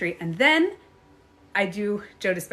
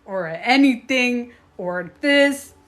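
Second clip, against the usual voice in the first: slow, louder no to the spirit; firm refusal; 2 semitones higher, 6 dB louder, slower than usual. Retold or imitated. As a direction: lay down the law to a ghost. Refusing the spirit moving her bed.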